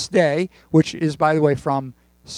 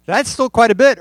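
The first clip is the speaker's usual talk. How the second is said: higher, louder put-upon complaint; mock complaint; 7 semitones higher, 6 dB louder than usual. Pastor tells a joke, Irving complaining to the clerk, higher mock protest. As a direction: whine it like a stingy husband. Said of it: "Irving complained".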